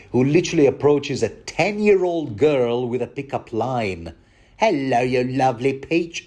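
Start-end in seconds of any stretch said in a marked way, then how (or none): 4.6-6.2 a hint of a sleazy Cockney villain accent; lightly put-on Cockney creep; creepy villain accent. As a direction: leer it like a Hollywood bad guy with that accent. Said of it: "villains also have to have this accent".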